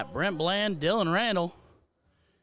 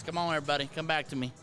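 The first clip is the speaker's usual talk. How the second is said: usual speaking voice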